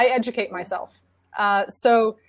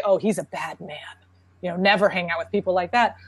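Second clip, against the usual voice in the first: judgmental bystander; 5 semitones lower than usual. Retold or imitated. Parent judging a yelling stranger to a child, low disapproving tone. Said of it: "I'm not gonna say".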